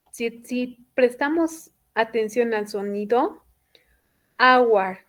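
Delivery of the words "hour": "hour" begun on the vowel, H silent. Shown as a demonstration. Teacher showing students the sound of hour, silent H. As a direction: say "hour" as if it had no H. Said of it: "como si no existiera esa H".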